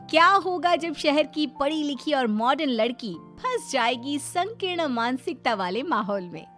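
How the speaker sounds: plain reading voice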